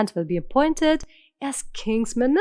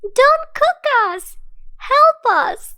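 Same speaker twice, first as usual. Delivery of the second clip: much higher, louder desperate squeak; tiny pleading fish; 6 dB louder, slower than usual. Acted. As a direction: cry for help like a little fish in the pan. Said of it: "the fish began to speak, saying".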